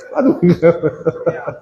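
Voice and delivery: slow laughing repeat; slow chuckling plea. Acted. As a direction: let the laugh break through slowly. Same line slowed, laughing.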